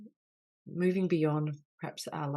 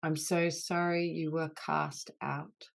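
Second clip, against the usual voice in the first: healing litany; 4 dB louder than usual. Acted. Leader voicing apologies to the wounded inner child, earnest and grave. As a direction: lead the group through each sorry with tender gravity.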